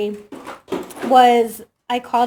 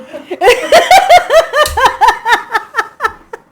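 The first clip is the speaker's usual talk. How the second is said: big high laughing fit; cracking up loud and long; loud burst of laughter; 11 semitones higher, 11 dB louder than usual. Real, not acted. Friend laughing hard at the dad story.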